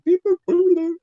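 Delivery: high, loud mouth-made beeps and boops; mouth-made synth beeps. Retold or imitated. Showing a guest the mouth-made intro, high imitated beeps. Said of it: "done it with their mouths and gone".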